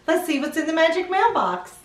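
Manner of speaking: ordinary delivery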